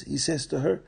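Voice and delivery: usual speaking voice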